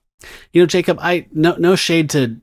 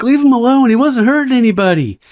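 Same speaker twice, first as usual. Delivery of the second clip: much higher and louder, indignant; protective hippie friends; 10 semitones higher, 4 dB louder than usual. Acted. The hippie friends shout at the creepy vampire, high and indignant. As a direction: stand up for your buddy with a high yelp.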